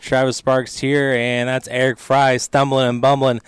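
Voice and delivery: loud, high radio host energy; loud show open. Real, not acted. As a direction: boom it out like a host.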